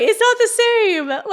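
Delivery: mock whiny protest, high, slow and loud; drawn-out, high-pitched mockery of a parent's excuse. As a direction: parrot the parent's lame excuse.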